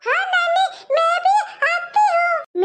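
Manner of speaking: put-on high, slow little-girl voice. Granddaughter answers her grandmother, high little-girl voice. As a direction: sound like a small girl calling to granny.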